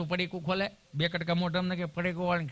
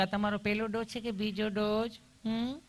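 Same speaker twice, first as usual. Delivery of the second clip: higher-pitched, cooing nurse voice; sweetly caring nurse; 3 semitones higher, 4 dB quieter than usual. Acted. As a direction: ask lovingly, ending on a soft little hum. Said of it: "તમે કેટલું પ્રેમથી પૂછો છો".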